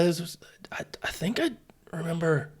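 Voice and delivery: breathy dazed whisper, high and soft. Retold or imitated. Retelling his concussion on the podium to friends, soft dazed whisper. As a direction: murmur it like you just got knocked out and can't recall.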